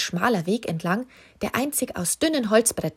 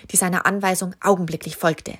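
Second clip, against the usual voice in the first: ordinary delivery, nothing marked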